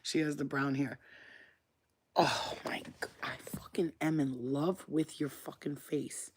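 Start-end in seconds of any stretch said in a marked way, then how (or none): 2.1-6.3 soft, overwhelmed whisper; adoring whisper. Real, not acted. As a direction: whisper as if overcome by cuteness. Adoring the pet up close.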